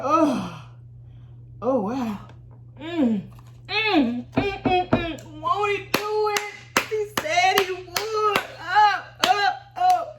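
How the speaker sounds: high, delighted oh-wow; overjoyed at the deposit; high giddy exclaiming; thrilled. Retold or imitated. Thrilled at the money.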